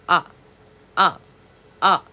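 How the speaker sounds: 아 said with the jaw dropped wide; wide open ah. Shown as a demonstration. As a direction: drop the jaw for 아. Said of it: "입을 크게 벌리라는 거야". (입을 크게 벌리고 아)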